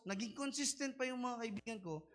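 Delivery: usual speaking voice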